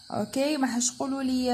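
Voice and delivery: ordinary delivery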